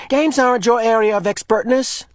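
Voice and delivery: high, loud indignant voice; boy angrily defending his role; angry. Acted. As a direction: insist on your authority.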